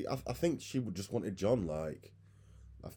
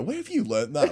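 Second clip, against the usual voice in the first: mock parent scolding a child, higher and louder; scolding a kid; 7 semitones higher, 9 dB louder than usual. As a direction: ask it like a parent catching a kid swearing. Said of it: "when a little kid swears and you go".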